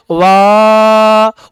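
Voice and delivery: वाह held out long, over three beats; prolonged vowel. Shown as a demonstration. Teacher demonstrating the three-beat वाह to students. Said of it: "वकार की ध्वनि आलाप करके आप बोलते हो".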